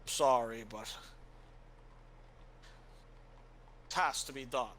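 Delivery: a warrior steeling himself to strike; grim resolve. Acted. Helwyn apologizing to Father before stabbing him, grim.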